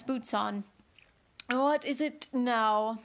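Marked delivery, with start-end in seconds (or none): 1.5-3.0 put-on slow, hoarse old frog whisper; deep hoarse whisper. A narrator's reading. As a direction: rasp it out slowly like a weary old frog. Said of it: "in a deep, hoarse whisper".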